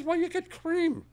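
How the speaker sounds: put-on very high, slow old-lady answer; little old lady. Old lady answering the shopkeeper's riddle, very high voice. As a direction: stretch it out way up high. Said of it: "a little old lady".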